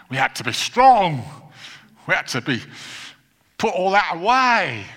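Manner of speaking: loud, high, emphatic push; mock stern demand. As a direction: raise the voice on each command, punching the last word. Mock voicing of old demand to be tough.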